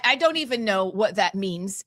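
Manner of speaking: usual speaking voice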